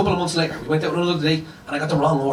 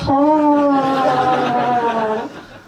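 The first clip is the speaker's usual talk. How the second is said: long, high-pitched fart noise; imitated fart; 7 semitones higher, 4 dB louder than usual. Nervous diner staring at the waiter, long imitated fart. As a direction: squeal out one drawn-out mock fart. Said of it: "farted like".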